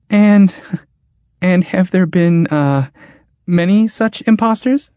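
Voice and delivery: high, timid, faltering question; "And, and" stumbled out weakly in fear. Acted. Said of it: "asked Churl in a weak voice".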